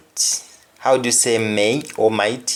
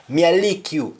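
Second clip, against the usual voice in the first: higher, punchy Jamaican Patois; 7 semitones higher than usual. Shown as a demonstration. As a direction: say it in Patois, pitch jumping up on "lick". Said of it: "So somebody says".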